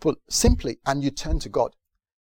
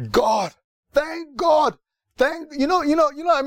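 relieved thanks; 10 semitones higher than usual. Retold or imitated. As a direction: stretch it out in overwhelming relief. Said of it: "You'd be like".

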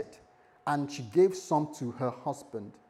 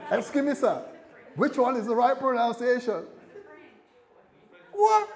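louder, higher playful banter; excited banter; 7 semitones higher, 6 dB louder than usual. Real, not acted. Preacher joking to the congregation about croissants, excited and louder. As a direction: hype up a mock contest.

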